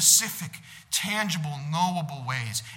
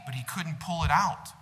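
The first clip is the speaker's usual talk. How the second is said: ordinary delivery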